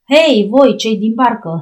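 a rough voice hailing the boat from the dark; harsh shout. Acted. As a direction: bark it at the boat like a customs officer. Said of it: "glasul cel aspru".

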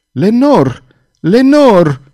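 loud, high cry of the name; desperate cry. A narrator's reading. Cardinal crying out to the woman he loves, desperate shout. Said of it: "țipă el".

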